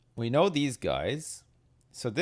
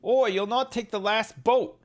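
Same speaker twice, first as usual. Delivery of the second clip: higher, louder imitated sailor shout; imitating a sailor; 10 semitones higher, 5 dB louder than usual. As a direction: bark it like the sailor in the game. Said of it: "and then he says".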